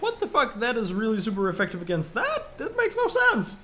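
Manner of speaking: high-pitched acted disbelief; squeaky mock outrage at nonsense; baffled outrage. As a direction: voice leaps up in indignant bafflement. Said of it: "I ended up being like".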